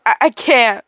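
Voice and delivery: usual speaking voice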